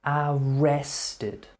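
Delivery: "Arrested" repeated clearly, a-RES-ted, falling; model word repeat. Shown as a demonstration. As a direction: say "Arrested" again for the learner to copy.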